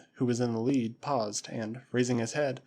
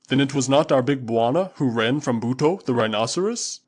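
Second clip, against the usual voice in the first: booming Waziri warrior; 7 dB louder than usual. Acted. Usula answering his master Tarzan, loud and proud. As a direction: call it out like a loyal tribesman. Usula asks about Buto.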